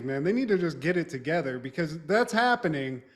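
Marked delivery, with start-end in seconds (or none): none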